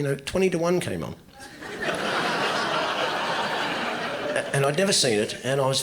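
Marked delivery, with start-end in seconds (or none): 1.4-4.5 long wheezing laugh; laughing hard, breath running out; burst of laughter. Real, not acted. Comic laughing hard at his own bit.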